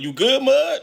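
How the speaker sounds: loud, high-pitched question; concerned check-in. Retold or imitated. Inmate to stuck man at the phone, loud concerned check-in. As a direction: call it over, voice shooting up.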